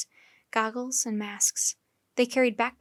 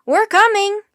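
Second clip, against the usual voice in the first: shouted call; 10 semitones higher, 12 dB louder than usual. A narrator's reading. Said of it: "in his deepest voice".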